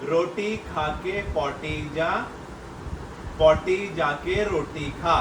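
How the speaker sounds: half-speed, each word separated; slow articulation. Real, not acted. Father repeats tongue twister for child, very slowly. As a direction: draw out every word so lips can be read. Repeats phrase for lip-reading guesser.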